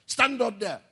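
barked order. Retold or imitated. Teacher ordering a child to stand, sharp command. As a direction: snap it like a teacher ordering a pupil.

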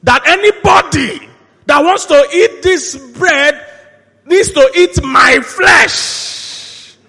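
high, very slow shouted declaration; acting out a preacher's forceful, drawn-out cry; thundering proclamation. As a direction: roar it out, stretching each word. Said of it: "He continued".

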